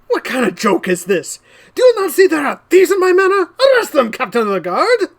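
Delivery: high, fast, outraged yell; enraged lord demanding arrest; furious noble shout. Acted. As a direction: shout the order at the captain.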